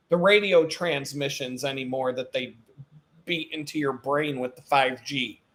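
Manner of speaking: ordinary talk, nothing marked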